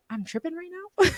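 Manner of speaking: high, astonished squeak; shocked disbelief. Retold or imitated. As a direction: gasp it out, stunned. Shocked realisation in dream.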